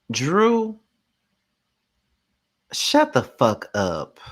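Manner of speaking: sighing, slow, fed-up scolding; exasperated sigh. Real, not acted. Fed-up host sighing at Drew on the show. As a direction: drag it out, completely done with her. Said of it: "exasperated reaction to Drew".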